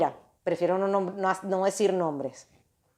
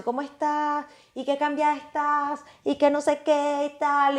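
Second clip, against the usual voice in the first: higher, slower, sing-song imitation; lightly mocking, higher chirpy "how are you"; friend's gushing greeting; 7 semitones higher than usual. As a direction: mimic an overly sweet old friend.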